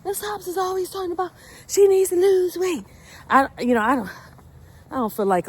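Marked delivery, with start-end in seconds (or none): 0.0-2.8 high-pitched imitation of gossiping students; put-on high student voice; mocking students' voice. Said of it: "for them saying".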